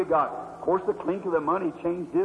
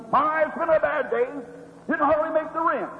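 grouchy shopkeeper; 6 semitones higher than usual. Retold or imitated.

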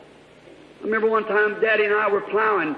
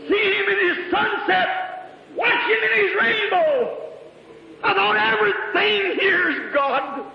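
full-force praise preaching; 7 semitones higher than usual. Acted. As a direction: lift each line higher in rapture.

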